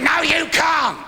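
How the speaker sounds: outraged refusal from the woman; indignant shout. Acted. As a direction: shout it back, high and loud. Woman refuses loudly in joke.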